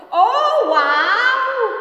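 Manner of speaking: very high, loud sarcastic squeal; mock him with fake awe; the voices in his head jeering at his boast.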